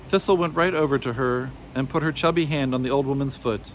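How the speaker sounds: usual speaking voice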